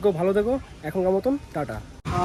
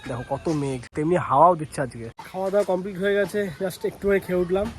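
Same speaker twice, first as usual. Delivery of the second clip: ordinary talk, nothing marked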